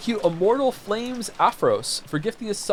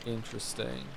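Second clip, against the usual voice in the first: low, much softer muttered interest; 7 semitones lower, 15 dB quieter than usual. Real, not acted. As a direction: say it under your breath, intrigued. Muttered quietly to himself.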